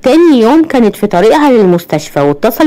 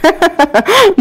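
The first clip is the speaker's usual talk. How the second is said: high short laugh; ضحكة; 9 semitones higher than usual. A narrator's reading. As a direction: laugh lightly, high in pitch. Character laughs in banter. (ضحكة ست قصيرة وعالية)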